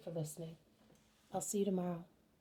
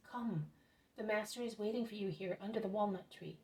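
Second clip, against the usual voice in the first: ordinary delivery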